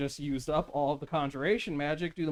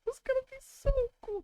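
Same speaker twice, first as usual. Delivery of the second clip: much higher, fast mock whine; high whiny mock wail; 3 dB quieter, much faster than usual. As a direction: echo it as a high, whiny complaint. Echoing friend in whiny voice.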